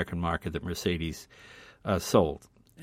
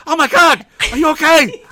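much higher and louder, alarmed shriek; panicked high cry; 13 dB louder than usual. Retold or imitated. Startled driver to bumped Leno, panicked high cry. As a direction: cry out in fright as if someone is bleeding. Driver sees ketchup as blood.

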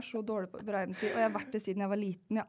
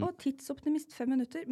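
higher, airy mock excuse; mock breezy excuse; 6 semitones higher than usual. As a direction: brush it off lightly, pitched up. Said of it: "en ting er sånn". (lett, hånlig unnskyldning)

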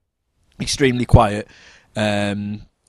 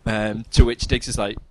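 ordinary delivery

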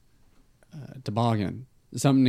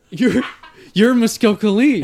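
surprised; 7 semitones higher, 6 dB louder than usual. Acted. Surprised recognition.

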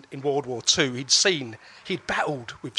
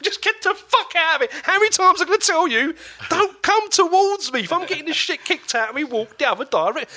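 louder and higher, fed-up shouting; exasperated yelling brother; 9 semitones higher, 5 dB louder than usual. Retold or imitated. Older brother yelling at little brother to stay away. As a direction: bark it like a fed-up big brother. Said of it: "that's why he's going".

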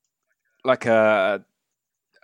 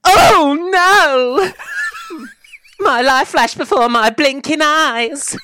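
high, loud, terrified yelp; panicked squeal; 8 dB louder, slower than usual. Acted. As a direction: squeal in mock terror for your life. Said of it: "he just goes".

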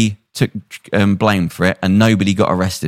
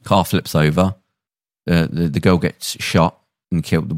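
ordinary talk, nothing marked

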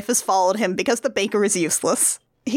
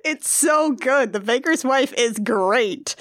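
usual speaking voice

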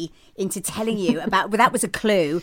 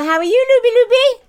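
playful sing-song; 13 semitones higher, 5 dB louder, faster than usual. Real, not acted. Playful greeting of co-host.

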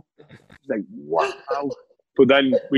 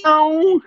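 imitated meow. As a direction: squeak out a silly cat meow. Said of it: "and he'd go".

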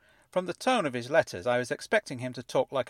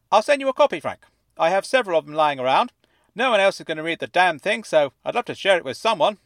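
high, fuzzy voice like it's underwater; fuzzy fish tank voice; 7 semitones higher, 8 dB louder than usual. A narrator's reading. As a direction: speak as if from inside a fish tank. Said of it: "as though he were speaking from the inside of a fish tank".

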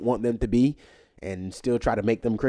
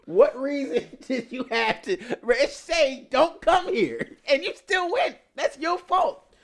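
much higher, laughing disbelief; incredulous rant; 4 dB louder, slower than usual. Real, not acted. Mocking the missionary who ignored the island, high and incredulous. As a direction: pile on the disbelief.

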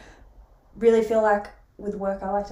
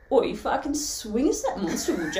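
exaggerated bogan Aussie accent, higher pitched; cheeky Aussie bogan accent, pitched up; broad Aussie voice; 4 semitones higher than usual. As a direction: ham up a broad Australian accent. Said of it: "It's not how we talk".

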